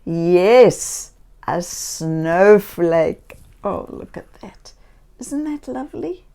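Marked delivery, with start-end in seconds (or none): 3.6-6.2 soft wonder. Acted. Admiring to children.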